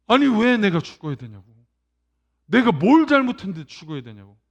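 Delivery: loud indignant demand; angry protest. Retold or imitated. Dying man protesting loudly against his death. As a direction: raise the voice in protest. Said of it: "분노하기 시작한다".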